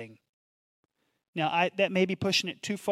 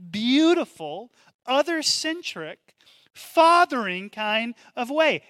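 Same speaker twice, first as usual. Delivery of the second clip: emphatic preaching; 6 semitones higher, 6 dB louder than usual. Acted. Preacher voicing Jesus, slow and loud.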